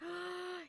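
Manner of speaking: quick starstruck intake of breath; excited gasp. Retold or imitated. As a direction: gasp like a fan who just got noticed. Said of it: "and I was like".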